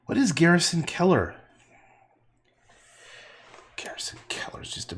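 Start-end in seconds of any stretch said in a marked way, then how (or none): 3.8-5.0 whisper. Real, not acted. Whispered aside.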